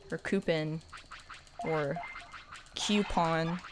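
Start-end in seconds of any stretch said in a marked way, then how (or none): 0.2-0.8 "coupon" with a weak UN ending; KOO-pun. Shown as a demonstration. Streamer listing rejected ways, "coupon" as KOO-pun. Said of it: "I don't say". 2.7-3.6 "coupon" starting like cue; KYOO-pon. Shown as a demonstration. Streamer listing rejected ways, "coupon" as KYOO-pon. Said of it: "I don't say".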